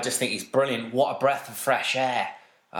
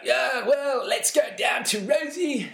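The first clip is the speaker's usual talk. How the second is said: higher, shouted broadcast hand-over; mock commentator; 10 semitones higher, slower than usual. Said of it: "it was like".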